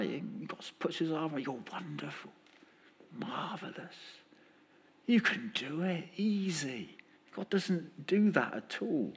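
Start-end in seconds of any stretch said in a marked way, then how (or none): 0.0-7.0 mock pep talk.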